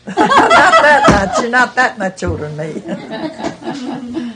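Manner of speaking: high, slow words through real laughter; giggling protest, pitched high; laughing. Real, not acted. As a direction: tease her while laughing. Teasing friend about age.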